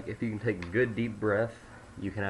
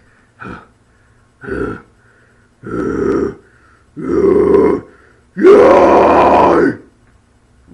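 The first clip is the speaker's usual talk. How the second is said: long, much louder fry scream; low fry scream; 9 semitones higher, 22 dB louder than usual. Shown as a demonstration. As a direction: roar it out from the stomach. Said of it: "it'll be like".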